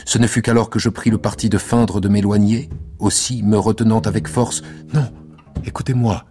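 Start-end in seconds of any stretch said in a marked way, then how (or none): 4.9-6.2 a touch higher, urgent plea; mild urgent outburst; quickly, urgently. A narrator's reading. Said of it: "dit-elle vivement".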